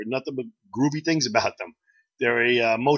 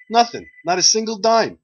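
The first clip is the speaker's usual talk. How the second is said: much louder, higher outraged complaint; indignant punchline; 11 semitones higher, 9 dB louder than usual. Acted. The grieving man complains to his friend, loud and outraged. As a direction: blurt the punchline like a greedy heir cheated.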